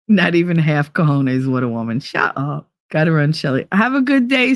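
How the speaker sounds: normal talking voice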